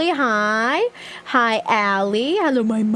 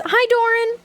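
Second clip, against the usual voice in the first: excited; 11 semitones higher than usual. Real, not acted. High excited greeting.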